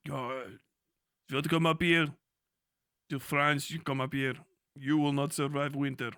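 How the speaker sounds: lightly put-on Russian accent, menacing; hint of a Russian boss threatening invaders; mock Russian accent. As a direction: warn them off in a thick Russian voice.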